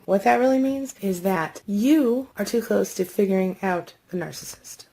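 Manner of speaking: ordinary delivery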